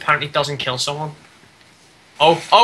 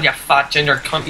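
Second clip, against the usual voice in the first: normal talking voice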